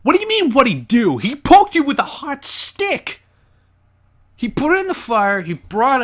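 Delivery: high, incredulous outburst; मज़ाकिया आक्रोश. Acted. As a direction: protest in disbelief, pitch way up. Mock outrage at co-player's question.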